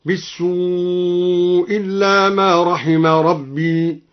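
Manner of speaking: slow chant, "بالسوء" as bis-suu running into "إلا"; dropped hamza. Shown as a demonstration. Reciter demonstrates al-Duri's reading, one hamza between "بالسوء" and "إلا". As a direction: hold "بالسوء" long and drop its final hamza. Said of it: "وقرأ بإسقاط الهمزة".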